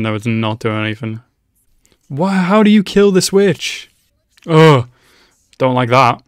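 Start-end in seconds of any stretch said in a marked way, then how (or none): 2.1-3.9 "How do you kill this witch?" shouted much higher and louder; frustrated yell. Real, not acted. Same question, now yelled. 4.5-4.9 loud groan of disgust; a loud, sharp ugh at the screen. Real, not acted. Disgusted reaction to scene.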